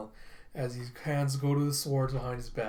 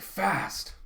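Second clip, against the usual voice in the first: high-pitched acted shock; stunned character voice; startled exclamation; 6 semitones higher than usual. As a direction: exclaim it, amazed by the speed. Kafka amazed by Hoshina's speed.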